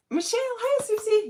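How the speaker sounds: high, loud hello to viewers; thrilled hello to two viewers joining; excited greeting. Real, not acted. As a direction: call out happily to new arrivals.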